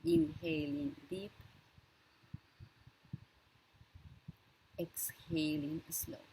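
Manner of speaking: unhurried breathing cues, leaving silence for each breath; guided breathing meditation. Real, not acted. Leads a breathing exercise.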